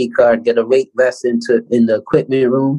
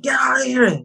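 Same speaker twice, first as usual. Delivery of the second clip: imitated coach's yell; 12 semitones higher, 4 dB quieter than usual. Coach throwing the players out.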